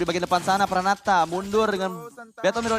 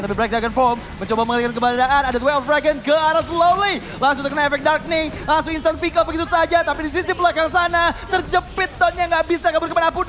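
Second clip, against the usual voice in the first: hyped live play-by-play, louder; esports caster; 8 semitones higher, 5 dB louder than usual. Real, not acted. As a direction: call the teamfight with rising hype.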